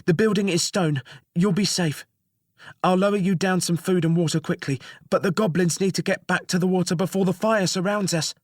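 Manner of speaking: high, hurried reassurance; jailer voice. Acted. Jailer reassuring the prisoner before fleeing the fire, high and hurried.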